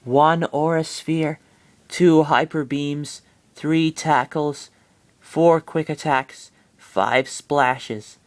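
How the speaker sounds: slow, dreamy counting; dazed counting. Acted. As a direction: count them off in a daze, slowly. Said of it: "talking with words that didn't made no sense".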